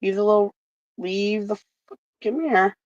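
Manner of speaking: ordinary delivery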